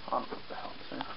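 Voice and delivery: whispered aside. Real, not acted. After misspeaking on camera, a self-conscious whisper. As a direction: drop to a whisper, embarrassed at the slip.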